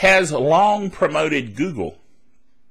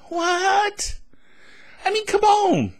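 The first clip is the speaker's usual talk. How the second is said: much higher, disbelieving "What?"; incredulous outburst; slower than usual. Real, not acted. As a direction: squeak it out in disbelief. Outraged at Apple's patent.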